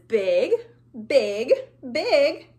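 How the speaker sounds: big voice. Shown as a demonstration. Teacher leading children's hand game, in a big voice. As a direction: make each repeat bigger.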